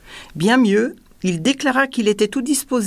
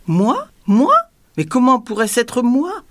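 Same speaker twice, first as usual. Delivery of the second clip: higher, rising questions of disbelief; simple, innocent exclamation; 6 semitones higher, 4 dB louder than usual. A narrator's reading. Dwarf innocently denying the noise to the tyrant, simply exclaiming. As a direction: protest lightly, as if astonished to be accused. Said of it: "mais avec tranquillité".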